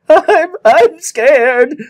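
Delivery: very slow, high, loud blubbering. A narrator's reading. Young John on the beam, blubbering with fear. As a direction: wail it out like a scared little boy. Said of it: "blubbered young John shamelessly".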